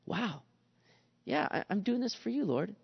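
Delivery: humbled reply. Retold or imitated. [name] humbled, answering the Lord softly. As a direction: answer quietly, caught out. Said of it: "the Lord's had to deal with me".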